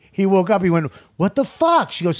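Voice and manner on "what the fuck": high, loud mock outrage on waking; retold startled outburst. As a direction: act out being punched awake. Boyfriend punched awake, retold.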